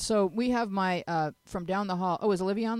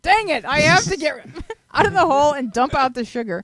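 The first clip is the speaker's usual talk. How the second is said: mock exasperation; 8 semitones higher, 12 dB louder than usual. Mock reader stuck in hole.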